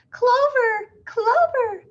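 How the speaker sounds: high, loud frantic scream; mother screaming for her child; frantic cry. A narrator's reading. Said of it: "cried Missus Hipple".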